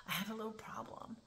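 sheepish whispered admission. Retold or imitated. Inmate admitting her problem to the officer in a whisper. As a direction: whisper it like a guilty secret.

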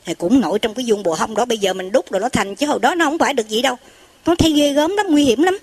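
ordinary talk, nothing marked

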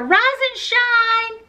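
high, drawn-out wake-up call; yelling. A narrator's reading. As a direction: sing out the wake-up, high and slow. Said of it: "she yelled".

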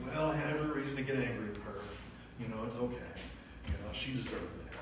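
mildly voiced self-justification, brushing it off fast; self-justifying inner voice. Inner voice justifying own sin.

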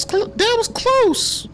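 slow, high falsetto relief; high relieved friend voice. Acted. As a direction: breathe it out up high. Friend character after near miss.

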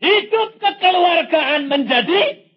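loud, high, slow preaching; climactic proclamation. Real, not acted. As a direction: proclaim it at full force, drawn out.

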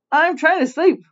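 mock grumpy protest; lightly acted grumble. Aunt Grouchy disturbed by the witch doctor, mildly grumbling. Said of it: "grumbled Aunt Grouchy".

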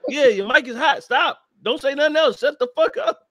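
high, loud imitation of a frantic warning; urgent lawyer warning. Imitating lawyer warning about hot mic.

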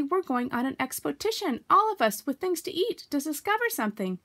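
high-pitched, excited announcement. A narrator's reading. Pooh tells Piglet about the expedition, excitedly. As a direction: bubble over with the news. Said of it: "said Pooh excitedly".

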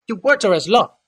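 careful servant choosing his words, pitched high; Hajar's voice. A narrator's reading. Hajar obeys the Kadir.

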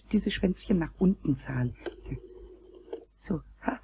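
whispered, soft and secretive; muttered concentration; whispering. Acted. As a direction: whisper to yourself while dialling. Concentrating while dialling.

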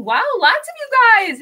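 real excited squeal of surprise. Real, not acted. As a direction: burst out delighted at the crowd. Delighted at the many viewers.

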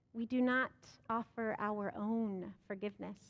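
ordinary delivery

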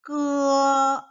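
slow, loud, high 끄어; drawn-out model syllable. Shown as a demonstration. Teacher modelling 끄어 slowly for children. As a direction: stretch 끄어 for the class to copy. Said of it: "한 번 더".

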